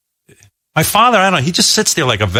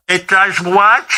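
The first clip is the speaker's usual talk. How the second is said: mom voice; 9 semitones higher, much slower than usual. Retold or imitated. Mother voiced in phone story.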